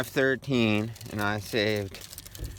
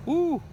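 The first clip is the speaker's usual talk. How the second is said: excited woo. Real, not acted. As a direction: let out a big cheer. Cheering while freezing, heading home.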